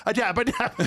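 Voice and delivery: high, laughing mock brag; laughing imitation. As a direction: squeal it through a laugh. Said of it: "and then it's like".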